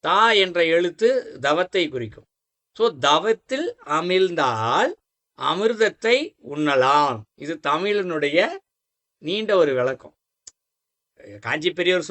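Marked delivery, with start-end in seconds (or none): 3.1-7.2 slow, stretched-out saying; measured recitation. Shown as a demonstration. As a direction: deliver it word by word, drawing out the endings. Slowly delivers the explanatory saying. (நிதானமாக இழுத்துச் சொல்லுதல்)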